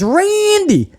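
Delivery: high, slow mock confession; mock Riddle. Mimicking Riddle to the hard cam, mock lovestruck. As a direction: swoon the line like a needy kid. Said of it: "he says".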